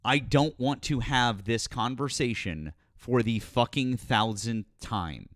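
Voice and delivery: slow, deliberate, word by word; emphatic repetition. Real, not acted. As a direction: spell it out slowly for someone not listening. Repeats refusal after not being heard.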